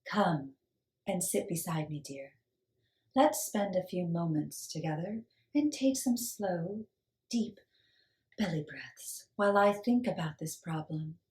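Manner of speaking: soft wise woman; spoke softly. Acted. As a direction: gently soothe the girl. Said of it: "Sophia spoke softly".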